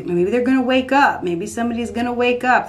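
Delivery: usual speaking voice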